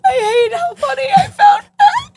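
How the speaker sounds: high, squealing laugh through words; laughing so hard it breaks into crying. Real, not acted. Cracking up at a joke.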